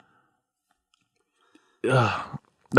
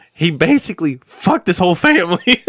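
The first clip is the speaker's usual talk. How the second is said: laughing; 7 semitones higher, 4 dB louder than usual. Real, not acted. Laughing while recounting.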